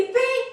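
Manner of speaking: high mimicked "ping"; imitated chime. Speaker mimicking the chime breaking into her music, high imitated ping. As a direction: sound the intercom tone high up in pitch.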